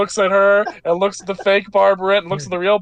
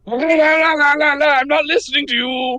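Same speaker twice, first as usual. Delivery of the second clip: high sing-song la-la-la, blocking them out; refusing to listen; 9 semitones higher than usual. Acted. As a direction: chant it like someone covering their ears.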